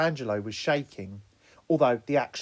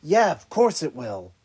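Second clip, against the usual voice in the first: lying; 2 semitones higher, 7 dB louder than usual. Acted. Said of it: "Raphael lied".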